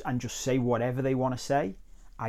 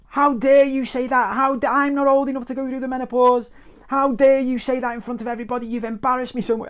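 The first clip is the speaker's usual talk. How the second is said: very loud, high-pitched indignant aunt; outraged outburst; 14 semitones higher, 11 dB louder than usual. Retold or imitated. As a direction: explode at a public insult, yelling high. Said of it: "the whole room just exploded".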